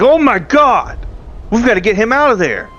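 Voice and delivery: high, urgent exclamation; alarmed outcry. Acted. Character sees the man at shrine.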